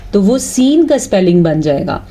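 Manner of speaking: ordinary talk, nothing marked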